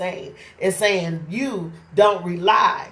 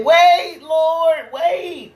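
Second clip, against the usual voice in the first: high, loud cry of wait lord; calling out; 9 semitones higher, 5 dB louder, much slower than usual. Retold or imitated. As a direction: call out to the Lord in a sudden high shout. Calling out to God.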